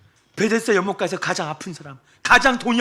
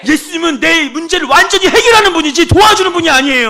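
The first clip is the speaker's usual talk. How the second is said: loud and high emphatic preaching; fervent sermon shout; preaching at full force; 10 semitones higher, 12 dB louder than usual. Acted. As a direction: drive the point home.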